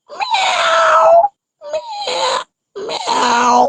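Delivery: very high drawn-out squealing meows; cat meowing. Pretend. Child showing viewers a cat, in a high squealing meow. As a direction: meow like a little kitten, stretched and high. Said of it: "আর বিড়ালেরটা করছে".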